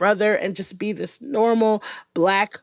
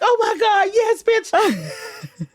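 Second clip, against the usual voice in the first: much higher, gleeful shriek of agreement; excited squeal; 12 semitones higher, much slower than usual. Retold or imitated. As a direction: hype each other up. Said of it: "They're like".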